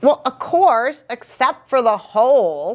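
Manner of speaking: high-pitched, defensive agreement; as if it were obvious all along. A narrator's reading. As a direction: brush off the correction. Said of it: "Little Blue's quite defensive".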